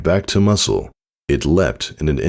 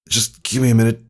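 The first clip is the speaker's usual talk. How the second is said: strained, breathless; 8 semitones higher, faster than usual. Acted. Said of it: "with heavy, trembling breath".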